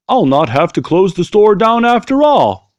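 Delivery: put-on a delighted old shop owner, lifted and loud; Mister Brown voice. A narrator's reading. Mister Brown tells his cat the store is saved, happy lifted voice. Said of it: "said Mister Brown".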